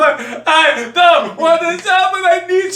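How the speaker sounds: high-pitched wailing to a parent; exaggerated retold crying on the phone; mock tearful wail. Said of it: "I called my mother in tears".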